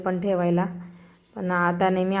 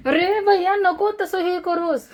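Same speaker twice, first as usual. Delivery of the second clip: pleading no; 9 semitones higher than usual. Real, not acted. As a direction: beg him to stop, pitched way up. Mother stops child again.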